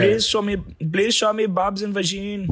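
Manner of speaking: high, slow imitation Indian accent; mock Indian accent. Guessing the creepiest accent for a co-host, mock Indian accent. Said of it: "the Indian accent".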